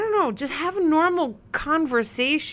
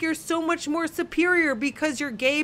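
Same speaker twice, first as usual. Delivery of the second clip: real exasperated rant, pitched up; aggravated; 6 semitones higher than usual. Real, not acted. Ranting to co-host about people acting superior, aggravated and high-pitched. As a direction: let the irritation push your pitch high. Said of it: "I'm getting so aggravated".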